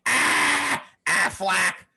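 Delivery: high, loud mock duck quack; imitating a duck quack. Comic imitating a man voicing his duck, loud and high. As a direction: squawk "Ah, ah, flack" like a decoy duck. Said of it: "grab the duck and just go".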